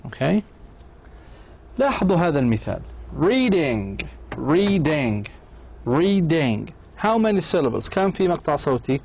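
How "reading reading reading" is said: "Reading" drawn out as rea-ding; syllable by syllable. Shown as a demonstration. Teacher gives learners an example to count syllables, syllable by syllable. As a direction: say "reading" in two even, separated beats. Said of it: "ما بين كل مقطع وآخر وقف قصير".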